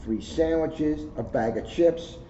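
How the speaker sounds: gentle storybook read-aloud; reading to children. Acted. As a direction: read it like story time for kids. Resumes reading the kids' book.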